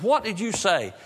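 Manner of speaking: high, insistent question; sharp demand. Retold or imitated. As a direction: press him for an answer, frustrated. Retold confrontation with mumbling young man.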